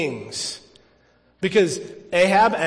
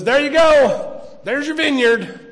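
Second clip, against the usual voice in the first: smug Jezebel; 7 semitones higher, 4 dB louder than usual. Acted. Jezebel handing over stolen vineyard.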